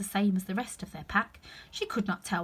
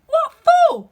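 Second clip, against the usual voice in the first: much higher, loud squawk; indignant outcry; 18 dB louder than usual. Acted. Gardener Two squawks at Five, high and loud. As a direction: yelp it in shock.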